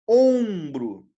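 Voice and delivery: Ombro with a long, loud nasal OM; stress on first syllable. Shown as a demonstration. Teacher showing the tonic syllable, stretched stress on Ombro. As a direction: hold the OM of Ombro, then drop. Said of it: "Qual é a sílaba tônica de ombro?".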